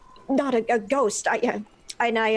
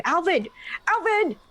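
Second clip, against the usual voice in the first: higher, calling a name across a distance; 7 semitones higher than usual. Acted. Bard calling after the invisible gnome, raised. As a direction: call to get his attention. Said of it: "try to call out his name".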